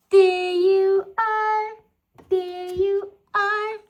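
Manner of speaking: loud slow delighted There you are; excited reveal. Real, not acted. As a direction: burst out with delight at finding her. Finding the pet, excited.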